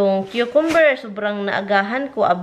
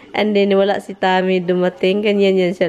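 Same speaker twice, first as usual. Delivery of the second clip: ordinary talk, nothing marked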